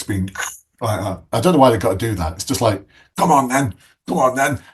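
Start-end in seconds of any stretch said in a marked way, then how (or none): 3.2-4.6 lightly mocked fighting call, higher; half-shouted mock challenge; rowdy challenge. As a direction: imitate drunk lads goading each other. Drunk men squaring up to fight.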